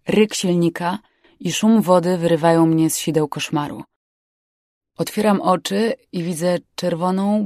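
usual speaking voice

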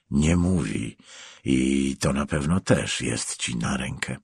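low, softened, controlled accusation; cold avenging stranger. Acted. Stranger silencing the prosecutor with his crimes, coldly accusing.

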